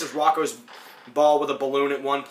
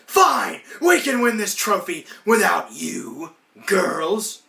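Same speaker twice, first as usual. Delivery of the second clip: higher, louder, slowed huffy voice; huffy cartoon Ed; 6 semitones higher, 4 dB louder, much slower than usual. Ed brushing off Virginia's quitting, higher huffy voice. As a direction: storm off in a huff. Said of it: "And he's like".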